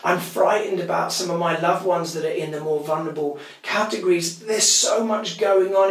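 ordinary delivery

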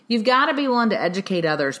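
normal talking voice

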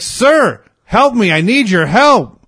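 high, loud imitated plea; mock screaming. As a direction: yell to a stranger for help, hammed up. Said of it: "He starts screaming at me to help him".

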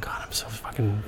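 seething angry whisper. Pretend. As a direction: whisper your fury through the teeth. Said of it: "so steamed right now".

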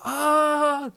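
high excited cry of greeting; mock call across room. She spots him and calls out, high mock squeal. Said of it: "ropar liksom över rummet".

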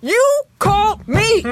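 high, slow put-on voice; high drawn-out mock voice; mock phone caller. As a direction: stretch it out like a fake sweet caller.

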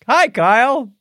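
mock cheery hello. Jokingly greeting absent friend.

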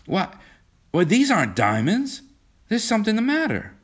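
high-pitched startled disbelief; acted shock, pitch climbing; dawning dismay. A narrator's reading. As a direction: stammer into a high, alarmed realization. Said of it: "His expression changed".

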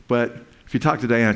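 ordinary talk

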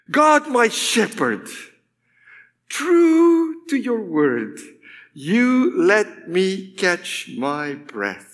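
slow, drawn-out recitation starting high; reverent psalm reading. Acted. Preacher reading a beautiful psalm translation, reverent and slow. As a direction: stretch each phrase out, then ease down.

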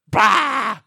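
sudden "bah!" burst; drawn-out mock jump-scare cry; monster jump-scare noise. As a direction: imitate a monster popping out. Imitating monster popping out.

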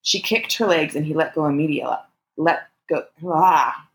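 ordinary delivery

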